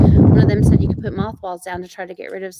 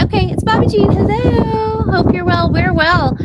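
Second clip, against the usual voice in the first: high cheery greeting; 11 semitones higher, 5 dB louder than usual. Real, not acted. Greeting viewer on live.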